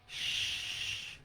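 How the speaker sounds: soft unvoiced shh; quieting a frightened child; hushing. A narrator's reading. Father hushes his crying son, soft.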